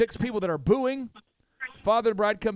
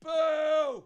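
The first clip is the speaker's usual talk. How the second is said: higher, shouted boo; heckler's boo; 8 semitones higher than usual. Retold or imitated. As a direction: boo like a guy at the back of the room. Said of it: "this guy in the back's like".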